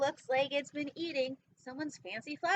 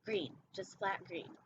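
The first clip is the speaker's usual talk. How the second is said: ordinary delivery, nothing marked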